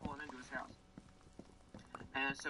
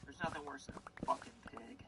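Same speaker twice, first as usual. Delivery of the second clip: ordinary delivery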